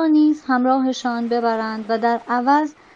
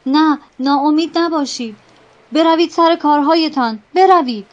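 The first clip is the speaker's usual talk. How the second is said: loud, higher furious command; angry shout; 4 semitones higher, 7 dB louder than usual. Acted. Captain ordering despairing sailors back, angry shout. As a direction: bark them back to work. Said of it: "ناخدا با خشم فریاد زد".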